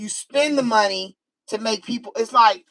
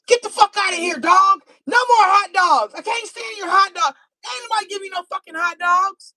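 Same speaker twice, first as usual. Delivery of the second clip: mock angry yell; 11 semitones higher than usual. Owner turning on the dog, mock furious yelling. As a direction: chase the dog off, shouting.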